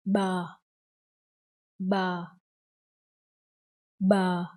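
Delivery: BA said alone, pitch sinking slightly; consonant demo. Shown as a demonstration. Teacher introduces the first consonant, BA modelled in isolation. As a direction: model BA clearly for repeating. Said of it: "The first one is".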